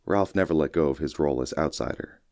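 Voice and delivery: ordinary delivery, nothing marked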